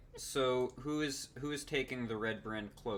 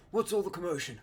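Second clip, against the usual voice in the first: guard voice; 6 semitones higher, 5 dB louder, faster than usual. Acted.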